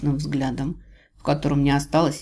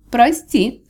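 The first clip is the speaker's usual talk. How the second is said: higher, louder mock apology; falsely sympathetic; 8 semitones higher, 6 dB louder than usual. A narrator's reading. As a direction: feign sympathy as an inner voice. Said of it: "с лживым сочувствием отозвался внутренний голос".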